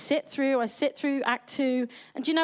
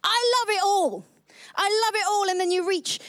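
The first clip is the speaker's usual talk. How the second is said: gushing delight; 7 semitones higher than usual. Real, not acted. Gushing about loving musical theatre.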